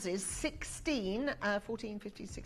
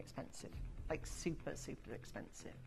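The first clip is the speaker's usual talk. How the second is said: half-whispered, almost gone; awed whisper; 8 semitones lower, 15 dB quieter than usual. Real, not acted. Presenter hushed by the price, whispering. Trailing awe at diamond prices.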